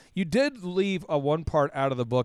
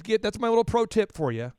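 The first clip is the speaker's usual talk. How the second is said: ordinary talk, nothing marked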